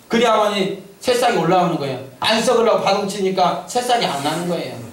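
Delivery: high-pitched, urgent sermon delivery; preacher driving the point home, pitched high; fervent preaching. Real, not acted. Preaches to congregation.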